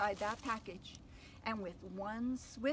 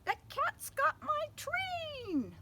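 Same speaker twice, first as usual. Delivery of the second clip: very high, slow wail; cartoon engineer wailing; panicked engineer; 4 dB louder, much slower than usual. Panicked engineer voiced in story.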